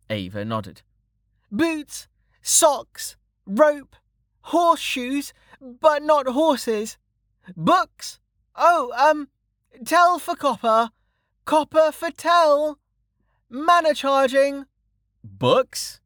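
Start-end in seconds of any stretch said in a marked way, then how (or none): 1.5-7.0 high sing-out list, item by item; little girl calling out wares; child hawker. Acted. 7.6-8.2 BOOKS called out very high; child hawker. Acted. Child calls out list of wares. 8.5-14.6 high sing-out list, groping for items; child hawker. Acted. Child calls out list of wares. 15.2-16.0 BOOKS as a rising question; interested customer. A narrator's reading. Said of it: "A familiar voice said".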